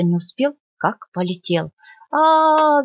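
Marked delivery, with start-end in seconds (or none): 2.1-2.8 high loud cry of surprise; hedgehog's yell. Acted. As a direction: yelp as he takes off into the air. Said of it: "закричал ёжик". (громкий высокий крик)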